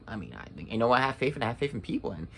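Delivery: mocking her doubt. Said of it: "she's like".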